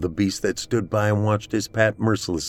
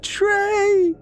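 very high-pitched cry of the name; terrified scream; 3 dB louder than usual. A narrator's reading. Celeste screaming for Trey as Pat stomps on her. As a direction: shriek it out high and desperate. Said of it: "she screamed as he stomped".

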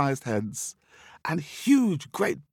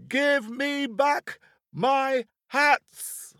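very high, drawn-out, slow furious yell; top of his voice; much slower than usual. Acted. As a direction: stretch every word in a livid scream. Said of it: "shouting at the top of his voice".

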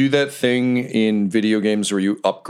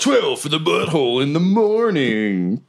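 radio DJ voice; 7 semitones higher than usual. Pretend. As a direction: pitch it like a morning-show host. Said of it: "Sound like a radio D J".

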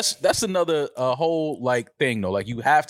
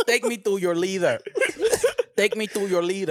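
put-on Dominican alien accent; 5 semitones higher than usual. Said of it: "That's a Dominican alien".

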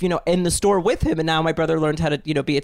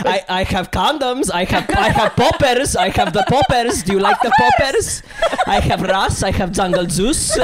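put-on Greek accent; 3 dB louder than usual. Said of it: "I can't do the fucking Greek accent".

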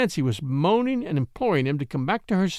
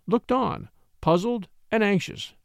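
plain reading voice

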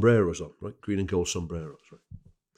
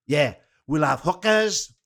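high, drawn-out put-on Welsh accent; Pontypridd-Merthyr accent; 8 semitones higher, 4 dB louder, much slower than usual. Fan promising a wild road trip, in a slow, high Pontypridd-Merthyr accent. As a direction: stretch it slowly in high Pontypridd. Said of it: "I'll do Miss Pontypridd, uh, slash Merthyr".

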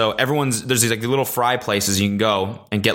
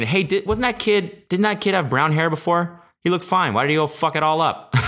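gossipy gym guy; 7 semitones higher than usual. Retold or imitated. Gym regulars' imagined thoughts.

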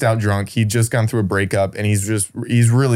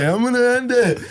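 mock drama; 11 semitones higher than usual. Drunk friend on roof.

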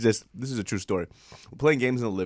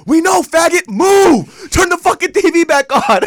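furious yell, much higher and louder; angry brother yelling; 14 dB louder, slower than usual. Retold or imitated. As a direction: scream at him to move out of the way. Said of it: "his brother goes".

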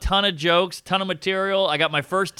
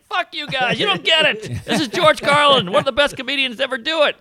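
pitched-up exasperated outburst; high indignant rant; 11 semitones higher, 3 dB louder than usual. Retold or imitated. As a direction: squeal it out in protest. Retold outburst, much higher.

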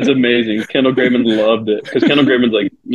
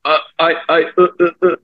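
higher-pitched halting mumble; imitated stammering; 6 semitones higher than usual. Heckler unable to answer the pitcher, imitated stammering. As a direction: stumble helplessly over the first word. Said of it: "fucking mumbling".

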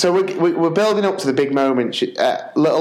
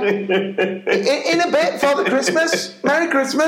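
bemused echo; 10 semitones higher than usual. Retold or imitated. Dad echoing Santa, bemused.

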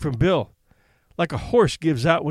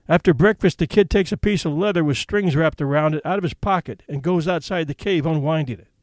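usual speaking voice

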